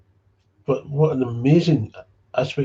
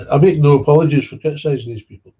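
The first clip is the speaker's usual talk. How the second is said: louder, higher defiant declaration; 5 semitones higher, 11 dB louder, faster than usual. Real, not acted. Defying critics of his attacks on sponsors, loud. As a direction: stand your ground loudly.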